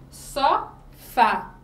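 "só, fá" split in two, the final "fá" punched loud; last-syllable stress. Shown as a demonstration. Teacher giving an oxytone example, stress on final "fá". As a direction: hit the last syllable hard in "só, fá". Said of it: "a tonicidade é na última sílaba".

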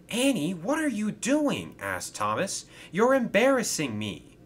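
high, flustered scolding; embarrassed protest. Acted. Thomas scolds his coach for embarrassing him, flustered. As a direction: object in embarrassed dismay.

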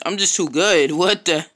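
loud cocky brag turning to shock; cocky outburst. Real, not acted. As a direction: brag it loud then get cut off. Boasting then sudden fail.

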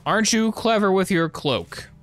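high mocking sing-song jab; sarcastic taunt. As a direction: tease the sneaky enemy. Taunting invisible enemy.

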